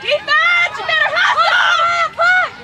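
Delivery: high, loud laughing shout; yelling from the sidelines. Real, not acted. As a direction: yell at the defense to push. Parent yelling at soccer game.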